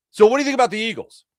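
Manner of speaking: a Philly fan cornering the sports host; pushy fan. Retold or imitated. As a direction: fire the question like a nosy fan.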